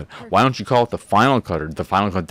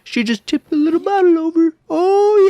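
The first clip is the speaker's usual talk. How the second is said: mock squeal; 5 dB louder than usual. Mocking squeal.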